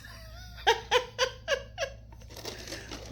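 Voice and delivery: squeaky laugh at herself; high giggle. Real, not acted. Laughs at herself eating ice.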